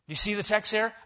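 ordinary delivery

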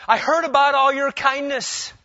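high and loud, proclaiming it; ringing declaration. Acted. Boaz declaring to Ruth, loud and high.

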